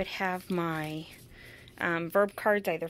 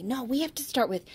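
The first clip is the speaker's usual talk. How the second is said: usual speaking voice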